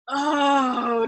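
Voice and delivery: a loud, crying moan tossed back. A narrator's reading. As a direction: moan back loudly at him. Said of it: "Dunbar moaned back at him".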